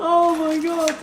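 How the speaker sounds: very high, loud laughing oh my God; laughing squeal. Real, not acted. Giggling with her friend over tokens, a high laughing shriek.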